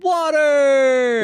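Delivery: high, loud, drawn-out yell; imitated crowd shout. Answering co-host with the crowd's chant, drawn-out yell. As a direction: stretch it out like a rowdy audience. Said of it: "what was their thing?".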